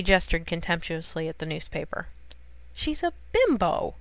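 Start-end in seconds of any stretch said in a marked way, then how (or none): 2.7-4.0 high, slow, sneering put-down; scornful snort. A narrator's reading. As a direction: spit out the contempt. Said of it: "Lois snorted".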